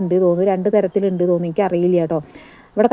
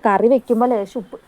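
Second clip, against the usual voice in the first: ordinary talk, nothing marked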